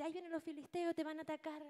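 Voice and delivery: high urgent alarm to wake him; alarmed call. Acted. Dalila despierta a Sansón. (Dalila despierta a Sansón, grito agudo de alarma)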